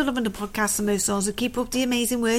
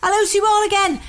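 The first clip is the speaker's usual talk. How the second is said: chirpy greeting; 8 semitones higher, 4 dB louder than usual. Acted. Reading listener email, greeting.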